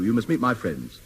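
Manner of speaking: usual speaking voice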